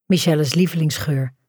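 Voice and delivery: usual speaking voice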